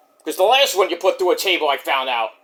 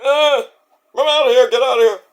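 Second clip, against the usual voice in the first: higher, dazed mock Cena mumbling off; 6 semitones higher, 3 dB louder than usual. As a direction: play a beaten wrestler staggering out. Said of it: "like, he goes".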